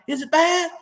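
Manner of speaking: high-pitched Alabama country twang, imitated; country Alabama accent. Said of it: "He's really country".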